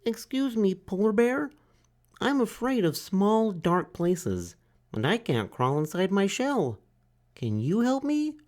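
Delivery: high, timid little turtle voice; small turtle Franklin. A narrator's reading. Franklin asks the duck for help, high and timid. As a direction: ask like a shy child.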